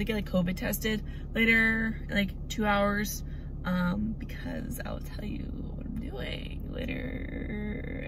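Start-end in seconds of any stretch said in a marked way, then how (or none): 4.2-8.1 secretive whisper. Real, not acted. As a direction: hush it like a secret. Teasing a secret.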